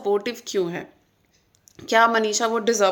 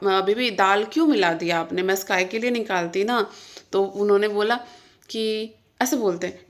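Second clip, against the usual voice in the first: normal talking voice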